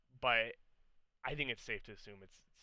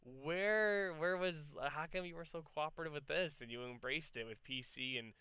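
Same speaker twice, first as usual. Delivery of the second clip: pitched-up, incredulous questioning; baffled protest; 5 semitones higher than usual. Retold or imitated. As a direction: act out bewildered objection to the company. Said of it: "I'm just like".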